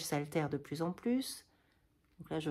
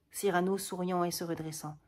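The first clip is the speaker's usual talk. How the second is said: ordinary delivery, nothing marked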